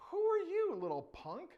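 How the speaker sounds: high, loud mocking dismissal; jeering, high-pitched put-down; scornful soldiers. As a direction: shoo off the little punk. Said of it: "they're like".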